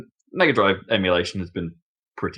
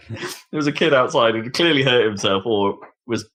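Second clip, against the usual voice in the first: ordinary talk, nothing marked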